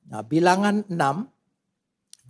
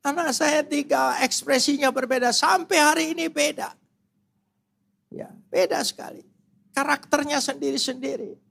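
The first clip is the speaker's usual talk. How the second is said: high, impassioned preaching; 11 semitones higher than usual. Acted. Preacher urging the church not to quarrel, impassioned. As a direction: lift the congregation up. Preaching peak, much higher.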